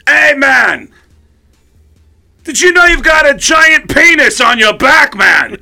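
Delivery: high, hollered impression of a rock singer. Singer pointing out drummer's tattoo, high loud shout. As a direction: holler it across the dressing room. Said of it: "he finally walks over and he goes".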